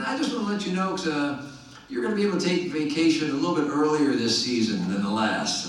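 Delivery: high-pitched, badly faked Virginia accent; hammy Virginia accent; [name]'s terrible Virginia accent. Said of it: "This is a terrible Virginia accent".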